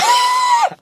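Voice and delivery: sudden squealing laugh, high; high burst of laughter. Real, not acted. Amused by her own story, a high squealing laugh.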